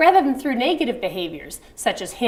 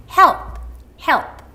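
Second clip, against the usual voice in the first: higher and louder, coaxing; coaxing to a baby; 7 semitones higher, 5 dB louder than usual. Shown as a demonstration. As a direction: call it gently to a tiny baby. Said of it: "like this".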